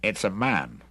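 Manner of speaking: plain reading voice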